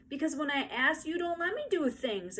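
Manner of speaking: put-on high, whiny tearful child; high sniffly little girl; sniffling exclaim. A narrator's reading. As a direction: blurt it out through sniffles. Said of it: "exclaimed Ramona, sniffing".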